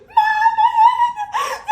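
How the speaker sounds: piercing high scream for mama; loud, drawn-out mock-hysterical shriek; acted-out scream. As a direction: yell it at the top of your voice, hammed up. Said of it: "I'm screaming".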